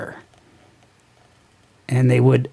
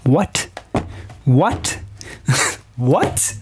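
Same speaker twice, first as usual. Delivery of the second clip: repeated disbelieving "What?", rising each time; stunned question that tips into laughter; real bafflement; much slower than usual. Real, not acted. Reader baffled by the comic's dialogue, incredulous.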